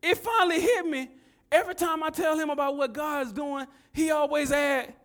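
loud, high-pitched preacher delivery; impassioned pulpit proclamation; full-force preaching. Real, not acted.

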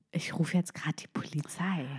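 geflüsterter innerer Entschluss; leise, fast geflüstert zu sich selbst; leiser Gedanke. Retold or imitated. Dramatisch gesprochener eigener Gedanke. (geflüstert)

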